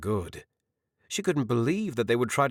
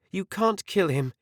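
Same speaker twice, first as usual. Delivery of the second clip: mustered confidence; 10 semitones higher than usual. A narrator's reading. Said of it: "with as much confidence as she could muster".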